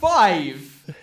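loud, high incredulous cry; shocked shout. Real, not acted. Player shocked at ordering five bottles, loud and high. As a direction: blurt it out in disbelief.

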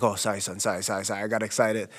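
sheepish apology. Retold or imitated. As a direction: back down meekly, imitating dad. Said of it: "And he's like".

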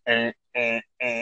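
imitating a half-asleep host's low-energy noises; groggy mumbling. Interviewer imitates host whose synapses aren't firing, groggy. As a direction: dull little grunts, brain not yet firing. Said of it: "hear your synapses, like, not firing".

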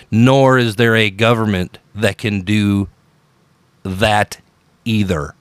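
deliberate, measured statement with pauses; slow and emphatic. Shown as a demonstration. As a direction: keep it slow, set the last words apart. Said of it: "I'll repeat that slower".

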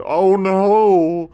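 high, loud alarmed cry; alarmed camel. Acted. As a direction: yelp in alarm. Scorpion falls in water.

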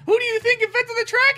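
smug frog. Retold or imitated. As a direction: brag in a hammy high frog voice. Acted smug frog boasting.

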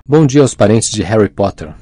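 usual speaking voice

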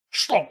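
loud, high "shlonk"; sudden sound effect for a violent grab; imitated ripping noise. Swayze tearing out the guy's throat, loud imitated rip. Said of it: "he just goes".

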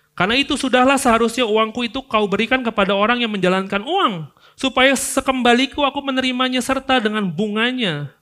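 high, loud scripture quote; master's stern reply; forceful reading. Acted. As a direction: proclaim the master's answer. God's answer read out.

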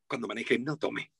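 low, soft drink-driving tag; friendly, no scolding. Shown as a demonstration. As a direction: advise gently, low and quiet. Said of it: "que no suene a regaño".

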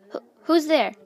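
startled yell. Acted. Luna startled by a voice in the shadows, yelled. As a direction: call out sharply into the shadows. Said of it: "Luna yelled".

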